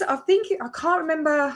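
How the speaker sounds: usual speaking voice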